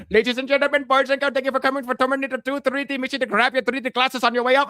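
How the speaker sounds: high-pitched mock Indian announcer; Indian accent. Greeter on the speaker welcoming Terminator guests, mock Indian accent. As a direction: put on an Indian-accented theme park announcement. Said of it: "I would do my Indian voice".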